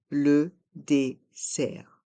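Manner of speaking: "Le dessert" in slow, separate syllables; slow model pronunciation. Shown as a demonstration. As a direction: pause after each syllable of "Le dessert". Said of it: "le R reste en suspens".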